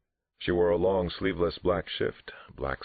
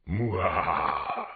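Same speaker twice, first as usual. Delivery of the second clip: mock monster growl-kiss; playful silly monster sound for the kids; hammed-up monster imitation; monster noise; 4 semitones higher than usual. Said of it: "I did my favorite monster imitation".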